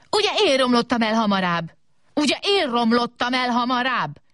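high-pitched, insistent squabbling; bickering. A narrator's reading. Two robots rivalling each other, bickering. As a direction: demand credit like quarrelling children. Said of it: "kezdtek veszekedni a robotok".

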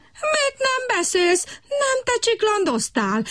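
high, slow indignant frog voice; a sulky frog protesting. A narrator's reading. Brekeki protests to Kutykurutyki, high indignant frog. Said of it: "méltatlankodott Brekeki".

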